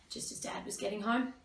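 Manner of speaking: ordinary talk, nothing marked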